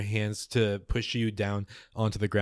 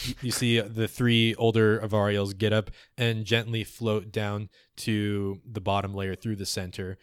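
normal talking voice

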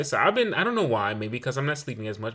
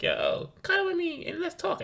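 imitating kid. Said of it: "they like".

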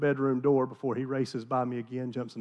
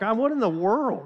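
shocked; 7 semitones higher than usual. Retold or imitated. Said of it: "I'm like".

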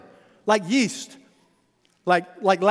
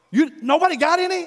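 cranky disciple; 10 semitones higher, 5 dB louder than usual. Retold or imitated. Hungry cranky disciples on boat.

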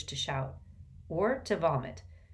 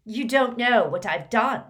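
loud, higher insistence; boy insisting to headmaster he is dangerous; forceful; 6 semitones higher, 9 dB louder than usual. A narrator's reading. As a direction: stress don't, nearly shouting. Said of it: "said Malfoy more forcefully".

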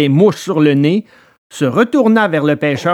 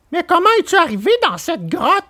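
much higher, quick eager puppet voice; curious puppet; 13 semitones higher, faster than usual. Acted. Pinocchio asking Alidor how he came, high eager voice. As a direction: ask the question eagerly.

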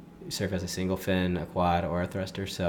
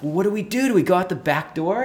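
much higher, louder, frantic asking; panicked question; 11 semitones higher, 7 dB louder than usual. Retold or imitated. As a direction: ask in a panic about escaping. Group panicking, asking what to do.

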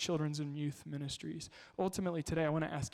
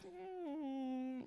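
whimper; 11 semitones higher, 8 dB quieter than usual. Retold or imitated. Imitating child's whimper.

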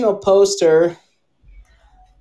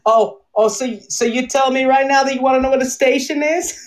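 higher mock New York, repeating the question back; New York accent; 7 semitones higher than usual. Tourist asks for the station, mock New Yorker repeats it. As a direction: stretch out the New York needling. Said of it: "a New York accent".

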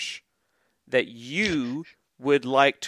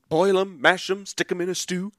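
Samwise Gamgee quote; 4 semitones higher than usual. Retold or imitated. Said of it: "reminded me of, uh, Samwise Gamgee".

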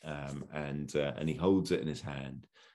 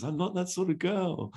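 high-pitched mock female voice; hammed-up high damsel; Dale. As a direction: protest primly, high and light. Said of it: "Dale just says".

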